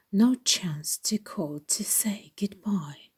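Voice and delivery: ordinary delivery, nothing marked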